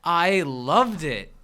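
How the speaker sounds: slow fake enthusiasm; forced excited. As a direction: drag it out with forced delight. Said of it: "most forced excited ever".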